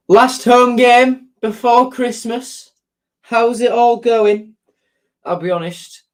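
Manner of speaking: usual speaking voice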